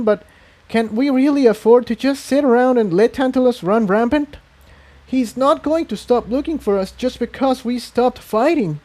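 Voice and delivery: pleading her point nervously; Tiptoe arguing. A narrator's reading.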